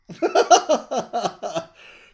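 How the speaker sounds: high, loud burst of laughter. Real, not acted. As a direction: crack up at the thought. Amused at Who fans seeing them.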